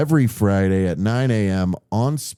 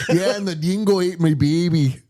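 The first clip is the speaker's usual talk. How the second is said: higher, hammed-up Aussie delivery; mock Australian accent; 7 semitones higher than usual. Host redoes the dingo line for a clip, mock accent. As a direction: say it again for the sound clip. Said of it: "Use that sound clip later".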